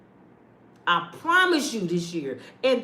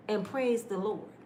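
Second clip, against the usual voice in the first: ordinary delivery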